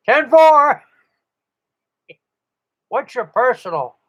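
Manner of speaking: high, loud mocking voice for the rookie; mock newbie on the radio. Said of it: "you hear 'em on Toomey".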